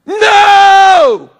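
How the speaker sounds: a raw, pleading yell at the judge; desperate shout. Retold or imitated. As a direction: cry No out high and loud, begging. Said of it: "I just started shouting at him".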